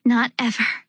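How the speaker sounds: gasping between breaths. A narrator's reading. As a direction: pant the words out. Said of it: "between broken bites of oxygen".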